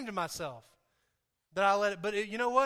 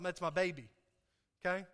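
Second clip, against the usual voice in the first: ordinary delivery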